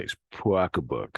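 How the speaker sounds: "pocketbook" as "pwockabook" with lips pushed out; Boston pocketbook. Shown as a demonstration. Teacher repeating the rounded Boston "pocketbook". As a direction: jaw forward, round W after P in "pocketbook". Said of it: "almost like P W O C K".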